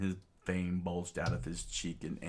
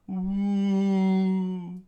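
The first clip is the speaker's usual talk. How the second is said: long, high, loud "ooh"; high held hooting noise; flare sound effect; 12 semitones higher, 8 dB louder than usual. Acted. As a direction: imitate a rising shot with a sustained hoot. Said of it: "to the sound of".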